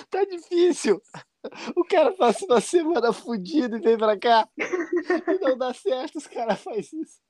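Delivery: high, tearful lament, lightly imitated; crying fan. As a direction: play a fan close to tears. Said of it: "começa a chorar".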